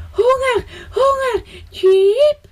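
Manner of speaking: high crying chirps; whining hungry chick. A narrator's reading. Baby sparrow dancing on the gutter, crying for food. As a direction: wail for food like a baby bird.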